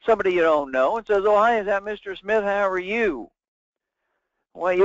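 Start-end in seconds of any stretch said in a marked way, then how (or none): 1.2-3.3 high-pitched imitation of a cold caller; mimicking a salesperson, pitched up; mock telemarketer. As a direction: put on an overdone sales-call greeting. Imitating stranger's sales call at night.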